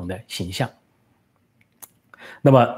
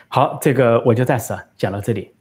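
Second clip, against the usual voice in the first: ordinary talk, nothing marked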